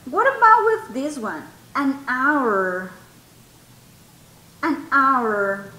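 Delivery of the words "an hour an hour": "hour" drawn out as "ow-er", no H; silent H. Shown as a demonstration. Teacher has students listen to the pronunciation, stretched H-less "An hour". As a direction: open "hour" straight on a held vowel. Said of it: "Si ustedes escuchan la pronunciación".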